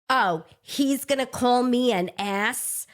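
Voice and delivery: put-on slow, heavy Brooklyn accent. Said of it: "I'm gonna do it with the Brooklyn accent".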